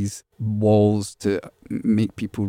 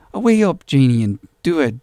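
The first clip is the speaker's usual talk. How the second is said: put-on louder, lifted Irish accent; coaxing call in an Irish accent; 5 semitones higher, 5 dB louder than usual. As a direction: imitate an Irish mother urging someone on. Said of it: "That's me doing an Irish accent".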